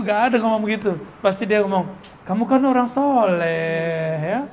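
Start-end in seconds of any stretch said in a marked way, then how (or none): 2.3-4.4 slow, silky tempting voice; lightly voiced sweet-talking Iblis; smooth devil flattery. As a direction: coax him like a devil whispering praise. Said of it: "Iblis tuh kalau ngomong pasti halus-halus".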